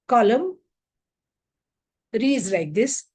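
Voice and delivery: usual speaking voice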